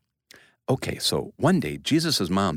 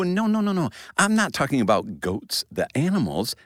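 ordinary talk, nothing marked

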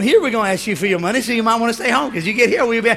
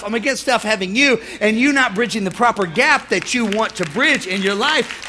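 usual speaking voice

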